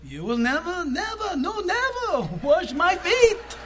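high, slow and forceful, the second "never" leaping up; everything flying. Shown as a demonstration. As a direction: throw it out with full passion, nothing held back. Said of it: "Everything's flying when they say it".

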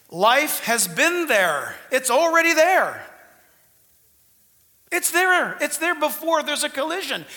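loud, emphatic sermon delivery; fiery preaching. Real, not acted. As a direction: preach it hard to the crowd. Preaching at full force.

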